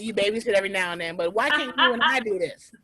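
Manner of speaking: ordinary delivery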